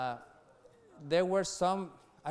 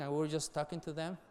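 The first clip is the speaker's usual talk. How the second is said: ordinary talk, nothing marked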